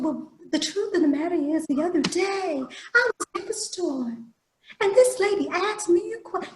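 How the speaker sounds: high, dainty lady's voice; prissy lady. Retold or imitated. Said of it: "a very prissy lady".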